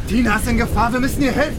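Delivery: normal talking voice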